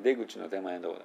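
normal talking voice

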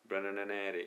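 ブラナナネリ said as one unbroken breath; linked syllables. Shown as a demonstration. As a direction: blend ブラナナネリ together with no vowels between. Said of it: "っていう感じでね". (ブラナナネリを息を止めずに一気に)

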